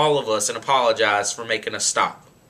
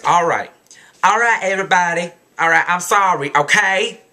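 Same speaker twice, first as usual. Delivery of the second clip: louder, grudging mock apology, pitched up; apology with attitude; 3 semitones higher, 4 dB louder than usual. Girl forced to apologize to the bus, with attitude. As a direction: throw out a sorry with attitude. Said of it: "do that without attitude".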